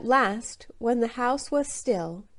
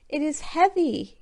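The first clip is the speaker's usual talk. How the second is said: shouted; 7 semitones higher than usual. A narrator's reading. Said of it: "he shouted".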